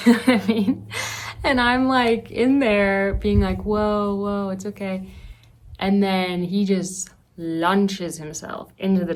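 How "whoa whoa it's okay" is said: ordinary talk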